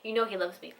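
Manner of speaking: ordinary delivery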